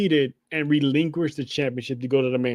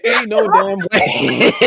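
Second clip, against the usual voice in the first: laughing disbelief; 5 semitones higher, 5 dB louder, much slower than usual. Retold or imitated. Laughing disbelief at her line.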